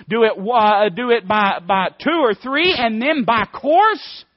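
usual speaking voice

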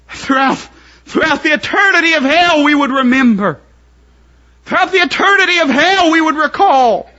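high, loud fervent preaching; preaching at full force. Acted. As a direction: cry it from the pulpit. Preacher climaxing.

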